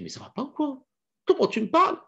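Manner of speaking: high, indignant wife retorting; wife hits back, outraged and high. Acted. Wife to husband who insulted her, high outraged retort.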